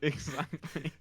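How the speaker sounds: real laugh through the word; helpless, giggly confirmation; laughing agreement. Real, not acted. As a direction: agree while cracking up. Cracking up at the boner joke.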